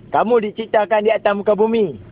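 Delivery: usual speaking voice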